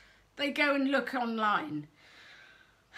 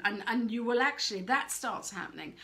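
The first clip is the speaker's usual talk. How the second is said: normal talking voice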